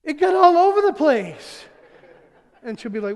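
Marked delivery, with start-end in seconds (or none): 0.0-1.6 higher, louder, slow, strained complaint; exasperated outburst. Retold or imitated. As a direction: blurt it out in disgusted disbelief. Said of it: "And I'll go".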